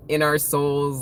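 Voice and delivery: usual speaking voice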